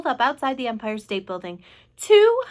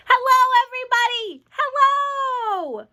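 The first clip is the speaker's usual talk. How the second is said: high, loud hail to a crowd; small boy's excited high shout; joyful calling out; 14 semitones higher, 7 dB louder, slower than usual. A narrator's reading. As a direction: call down gleefully, stretching the last hello. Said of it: "laughing and waving and calling out".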